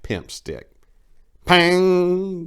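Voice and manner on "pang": mock clang. A narrator's reading. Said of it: "declared a solid shot".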